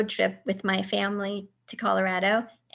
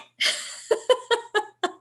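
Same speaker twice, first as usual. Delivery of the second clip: high laugh; 12 semitones higher than usual. Real, not acted. Laughing at her own shock.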